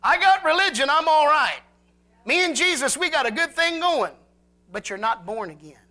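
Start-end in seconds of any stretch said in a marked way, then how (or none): 0.0-4.2 a mildly raised, self-satisfied churchgoer voice; mock the smug believer, a touch higher; smug modern-day Pharisee. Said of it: "it's called modern day Pharisees".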